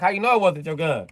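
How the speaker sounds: high, loud accusing question; prosecutor grilling. Acted. Prosecutor grilling the accused, loud and high. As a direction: press him hard.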